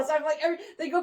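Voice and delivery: ordinary delivery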